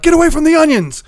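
high, loud mock alarm; startling yell. Husband freaking out his sleep-babbling wife, startling yell. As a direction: blurt it out as a sudden high yell.

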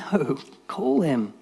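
slow, part-whispered summons; hushed imitation. Jesus receiving Bart, a slow half-whispered summons. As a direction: slowly half-whisper the quote.

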